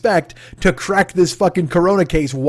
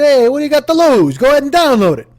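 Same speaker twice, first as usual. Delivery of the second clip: high, louder pitchman pitch; mock pitchman; 9 semitones higher, 4 dB louder than usual. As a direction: push it like a cheesy ad.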